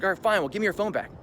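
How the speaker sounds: high, lightly mimicked insistence; imitated demand. Man grabbing pockets wants phone back, imitated demand. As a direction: pitch it up as the other man. Other man's impatient demand, retold.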